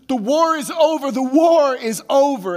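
very high, loud, slowing proclamation; a messenger shouting the war has ended to the crowd; triumphant shout. Retold or imitated. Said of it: "And he says".

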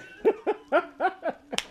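a high, delighted laugh; high burst of laughter. Real, not acted. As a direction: crack up out loud. Host laughing at the answer.

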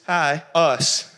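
ordinary delivery

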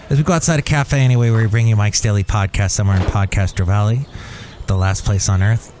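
rapid, low show-intro patter; podcast ident patter. Acted. Host reminding listeners where they are, rapid ident patter. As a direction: rattle off the station ident, fast and low.

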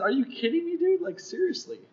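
high-pitched mock exasperation; incredulous protest. Pedestrian to old honking driver, incredulous. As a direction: throw your pitch up in retold disbelief.

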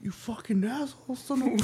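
hushed, high imitation of a cursing child; mock sneaky whispered swearing; retold kid whisper. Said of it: "He starts whispering".

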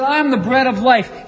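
loud preacher's cry of "I am"; booming proclamation. Acted. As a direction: proclaim "I am the bread of life" to the crowd. The claim proclaimed loudly.

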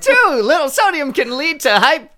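put-on high, bright transatlantic accent; glamorous old Hollywood star. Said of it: "A transatlantic accent".

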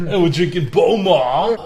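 attempted Scottish accent. Shown as a demonstration. Friend showing co-host the brand name, attempted Scottish accent. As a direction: have a half-serious go at a Scottish accent. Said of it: "trying to do a Scottish accent".

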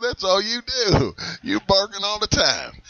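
ordinary talk

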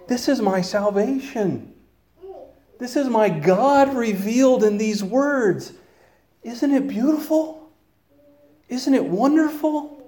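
rising to high, awed wonder; rapturous delight. Retold or imitated. Preacher voicing a believer gushing over scripture, high and awed. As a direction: marvel at it as if seeing it fresh.

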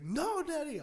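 put-on high, pleading child's voice; little kid whine. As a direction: whine like a small boy. Son protesting to his dad.

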